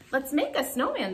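mild storybook exclamation; children crying out. A narrator's reading. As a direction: call it out like eager children. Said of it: "the children cried".

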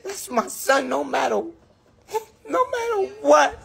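real tears, voice rising high and breaking; crying out that he's her son, pitch climbing. Real, not acted. Mother declaring her love for her son, breaking into real crying. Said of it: "Yeah, I'ma cry because it gets me emotional".

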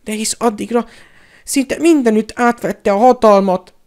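high, loud cowardly whine; plaintive self-excusing bleat; whining. A narrator's reading. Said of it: "nyafogta Pettigrew".

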